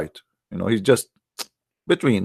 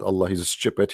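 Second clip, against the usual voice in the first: ordinary delivery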